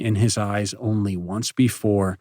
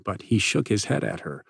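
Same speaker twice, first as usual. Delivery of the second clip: usual speaking voice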